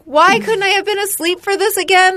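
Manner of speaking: high-pitched, pleading protest; exasperated complaint. Retold or imitated. Patient retelling wishing to be asleep, high exasperated protest. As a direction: re-enact a distressed, fed-up wail. Retold protest during painful procedure.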